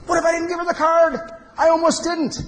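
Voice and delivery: high-pitched retold panic; alarmed realization. Speaker reliving his near miss with the card, alarmed. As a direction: let the voice leap up in mock alarm.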